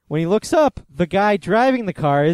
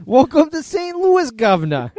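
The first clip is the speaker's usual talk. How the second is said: much higher, hammed-up fake Saint Louis accent; horrible Saint Louis accent; 10 semitones higher, 3 dB louder than usual. Podcaster repeating the joke welcome, absurdly high fake Saint Louis accent. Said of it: "That's a horrible Saint Louis accent".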